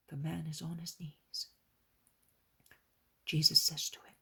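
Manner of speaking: usual speaking voice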